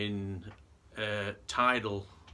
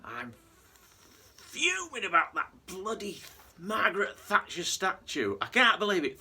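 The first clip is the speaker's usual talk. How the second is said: higher, louder angry union man rant; fuming rant; 9 semitones higher, 3 dB louder than usual. Retold or imitated. Angry union man rants to pub locals, furious higher voice. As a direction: rage about the statue like a furious union man. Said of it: "he's absolutely fuming".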